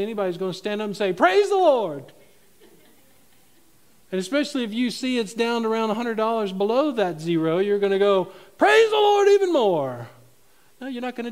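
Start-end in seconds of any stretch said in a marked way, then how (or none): 1.1-2.1 high, loud, drawn-out hallelujah cry; mock praise shout. Preacher jokes about praising over an empty bank account, mock shout. 8.6-10.1 very high, very loud mock jubilation; over-the-top praise shout. Preacher jokes about praising harder when overdrawn, over-the-top mock shout.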